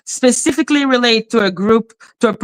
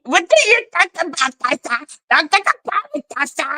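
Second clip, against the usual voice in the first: mocking her own words; 8 semitones higher, faster than usual. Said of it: "Like me".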